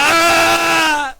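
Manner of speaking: loud, high, sustained imitated scream; demon's scream. Priest imitating the possessed before the Santísimo, long scream. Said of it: "y grita".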